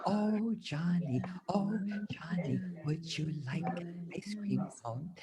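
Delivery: whispered singsong; softest whisper. Pretend. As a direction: whisper the song very softly. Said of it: "in her very softest voice".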